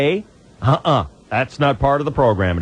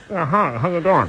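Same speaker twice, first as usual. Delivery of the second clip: hand over mouth; 3 semitones higher than usual. Retold or imitated. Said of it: "Sounds to me like you just kinda went".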